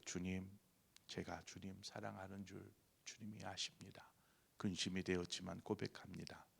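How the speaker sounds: hushed, unvoiced confession; whisper. Peter answering Jesus, a hushed whispered confession. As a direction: breathe it out timidly.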